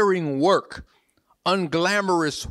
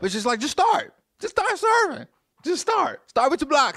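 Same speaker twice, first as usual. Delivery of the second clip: excited preacher's exhortation, going higher; rising urgent push; 10 semitones higher than usual. Real, not acted. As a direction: pitch climbing much higher, insistent. Urging the church to serve.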